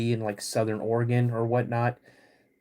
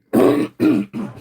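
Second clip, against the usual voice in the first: sharp, loud clearing of the throat; hacking throat clear, getting over a cold; 11 semitones higher, 9 dB louder than usual. Real, not acted. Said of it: "I'm almost over this cold".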